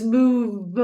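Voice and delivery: "move" drawn out, hitting the final v hard; obnoxious exaggerated pronunciation. Shown as a demonstration. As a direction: say "move" slowly and obnoxiously, overdoing the v sound. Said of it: "say it in a really obnoxious way".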